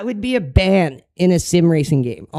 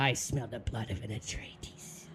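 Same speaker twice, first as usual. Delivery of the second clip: unvoiced, menacing whisper; eerie whisper; 9 semitones lower, 19 dB quieter than usual. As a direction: breathe it like a prophecy. Whispered ominous movie quote.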